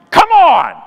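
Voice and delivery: loud, high shout; yell. Retold or imitated. Canadian finally swears at the flight crew, yelling. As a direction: blast it out in frustration. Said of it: "I look around and yell".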